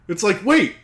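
ordinary delivery

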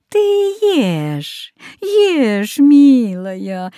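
put-on high, coaxing old woman voice; sweet old witch. A narrator's reading. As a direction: coax her like a kindly granny. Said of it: "ласково говорила старуха".

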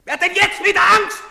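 high, loud jeering shout; shouted taunt. Acted. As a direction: sneer it out loud. Soldier mocking the crowd.

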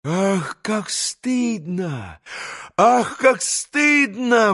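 ashamed exclamation. A narrator's reading. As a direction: lament in shame, stretching each word. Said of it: "воскликнула Грушенька". (протяжное восклицание стыда)

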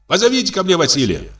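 high, quick summons; calling out. A narrator's reading. Landowner sending for his coachman, calling out. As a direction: call toward the house, high and fast. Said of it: "кричит он".

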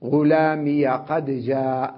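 slow غلامي said as ghulamiya; ya with fatha. Shown as a demonstration. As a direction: link غلامي to قد with an open -ya. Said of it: "هكذا يقول". (فتح الياء في الوصل)

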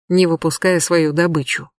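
usual speaking voice

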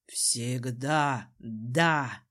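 low, very slow man's voice; devoted Desmond. A narrator's reading. Desmond assures his queen, low and very slow. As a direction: draw it out deep and certain.

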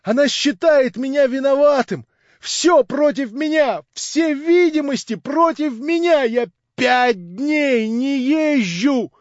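high, loud excited shouting; crying out to the room in rapture. A narrator's reading. Young man shouting to the narrator, overjoyed. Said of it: "кричал он".

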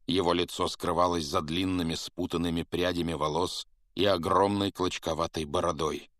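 ordinary delivery

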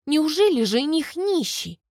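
high, feigned shock; playfully exaggerated dismay; mock horror. A narrator's reading. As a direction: pretend to be appalled. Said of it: "Притворно ужасаюсь я".

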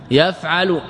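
يفعل pronounced yaf'alu, a following the ayn; fatha on the ayn. Shown as a demonstration. Teacher naming each vowel for students, fatha demonstration. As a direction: put a clear a in the middle of يفعل, yaf'alu. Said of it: "بفتح العين".

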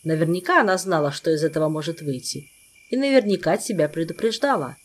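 ordinary delivery, nothing marked

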